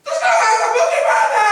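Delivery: high, loud panicked wife's shout. Retold or imitated. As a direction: yell it like a wife in a panic. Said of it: "Mungkin istrinya bilang".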